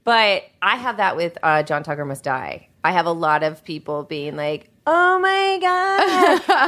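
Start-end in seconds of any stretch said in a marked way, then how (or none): 4.9-6.7 high, drawn-out starstruck gasp; mock gushing fan swooning. Fan meeting star, gushing in high slow awe.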